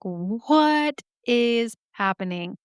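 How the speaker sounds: slow, drawn-out mock bafflement; baffled. Researchers finding a message in the sealed room, slowly baffled. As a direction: stretch each word in disbelief.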